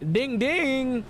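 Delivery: very high, stretched singsong dings; mock doorbell. Delivery driver announcing himself at the door, singsong.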